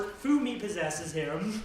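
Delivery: normal talking voice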